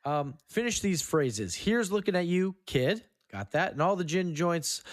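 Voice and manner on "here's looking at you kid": ordinary talk, nothing marked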